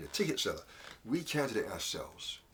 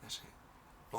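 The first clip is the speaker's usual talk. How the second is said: hushed letdown; 11 dB quieter than usual. Acted. Count stops short.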